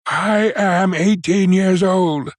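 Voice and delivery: slow, high, emphatic declaration; firm voice. A narrator's reading. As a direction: insist firmly, drawing out each word. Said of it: "repeated in a firm voice".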